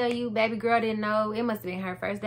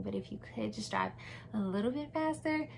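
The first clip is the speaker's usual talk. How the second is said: sweet pleading; 6 dB quieter than usual. Retold or imitated. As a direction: coax him sweetly, babe. Sweetly pushing husband to speed.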